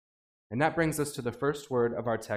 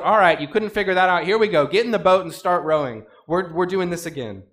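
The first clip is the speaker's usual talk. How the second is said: loud, brisk and impatient, hurrying them along; a coach barking orders at a failing team; exasperated Jesus; 3 semitones higher, 7 dB louder than usual. Acted. Jesus shoving the disciples into the boat, loud and impatient.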